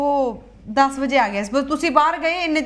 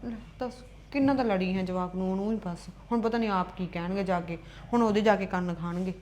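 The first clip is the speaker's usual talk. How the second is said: low, very soft grumble to oneself; worried muttering; 9 semitones lower, 10 dB quieter than usual. Acted. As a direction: mutter under your breath, fretting. Left alone, worries aloud.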